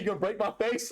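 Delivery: high mock challenge; imitating Dustin. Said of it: "He said".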